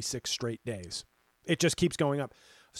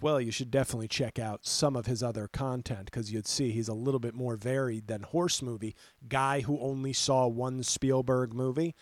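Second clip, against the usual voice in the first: usual speaking voice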